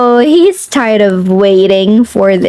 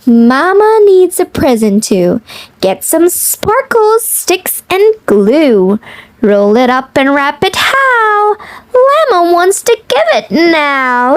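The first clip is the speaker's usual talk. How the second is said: lilting picture-book read-aloud; reading to children; 3 semitones higher than usual. Acted.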